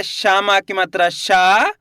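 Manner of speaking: spelling-out, final शा held long and rising; drawn-out vowel. Shown as a demonstration. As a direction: stretch the aa of शा. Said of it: "तो खींच के पढ़ेंगे". (शा को खींचकर बोलना)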